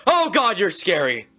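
loud, high yelp of alarm; startled. Real, not acted. Player sees a scary figure, startled outburst.

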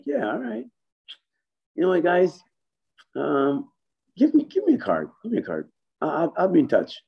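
squeaky excited voice of a salesman meeting a star; imitating Kenny. Kenny voiced at trade show.

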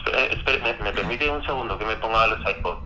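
ordinary delivery

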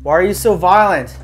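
calling out to spirit. Real, not acted. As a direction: demand an answer from an unseen presence. Challenging the spirit aloud.

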